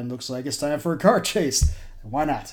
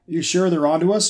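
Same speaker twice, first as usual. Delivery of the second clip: raised, higher shout; 6 semitones higher, 6 dB louder than usual. Acted. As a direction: call out over the engine. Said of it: "Joe shouted".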